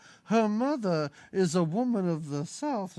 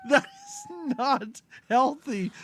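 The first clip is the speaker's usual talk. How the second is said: high-pitched comic disbelief, then slowing; appalled protest; 8 semitones higher, slower than usual. Retold or imitated. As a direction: wail the question, then scold slowly. Acting out appalled reaction to film.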